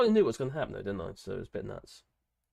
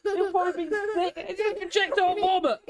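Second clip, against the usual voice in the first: laughing; 13 semitones higher than usual. Real, not acted. Still laughing about the vomit.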